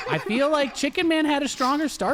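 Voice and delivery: high, loud grandiose commentator; grand announcer. Acted. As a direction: hail the athletes with mock pomp. Mock pompous post-match speech.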